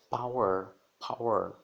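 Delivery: power, paw-er. Shown as a demonstration. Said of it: "já tem aquele som de A". (power com som de A)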